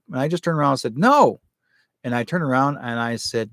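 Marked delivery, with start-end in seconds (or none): 1.0-1.4 sudden high, loud "No" at the dream witch; retold defiant shout. Turning on the witch chasing her in a dream, a loud high defiant shout.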